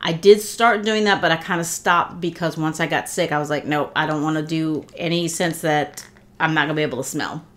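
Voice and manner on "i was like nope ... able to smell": ordinary talk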